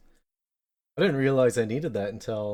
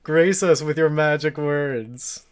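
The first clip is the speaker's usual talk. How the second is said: each word stretched out grandly; slow drawn-out mock plea; 5 semitones higher, 9 dB louder, slower than usual. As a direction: drag it out like a solemn invocation.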